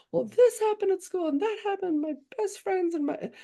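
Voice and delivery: high-pitched imitated kid venting; upset daughter's voice. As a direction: pour it out like a wounded child. Voices upset daughter spilling school troubles.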